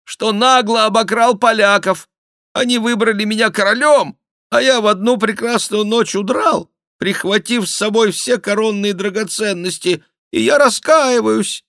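high, loud penitent voice; put-on voice of a sham king; mock-king confession. A narrator's reading. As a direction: confess loudly, high above your own voice. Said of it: "продолжал мнимый Генрих".

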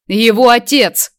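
loud slow bark. A narrator's reading. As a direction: bark it out angrily. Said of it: "рявкнул тот".

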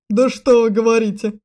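loud, biting sarcasm; forceful venomous retort. A narrator's reading. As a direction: say it with poisonous mock surprise. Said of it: "ядовито произнёс принц".

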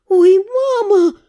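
숨 막히는 감탄; breathless gasp. Acted. Heroine gasps seeing him.

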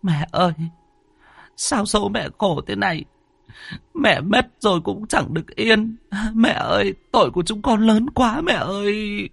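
high keening cry of grief; wailing lament. Acted. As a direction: wail over the mother's coffin. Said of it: "mà gào lên".

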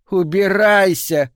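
high, loud, drawn-out yell; furious dismissal. A narrator's reading. Woman throwing her lover out, furious dismissal. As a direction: scream him out of the room.

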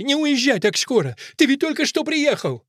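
high-pitched pleading outcry; Fusia. A narrator's reading. Fusia begs Akelina not to leave, crying high. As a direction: cry out, begging him to wait. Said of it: "кричит Фуся".

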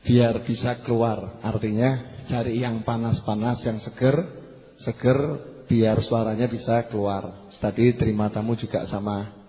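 usual speaking voice

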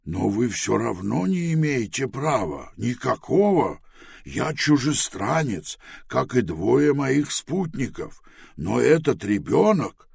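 a lightly voiced worried man; anxious pleading man. Pilorot's quoted speech.

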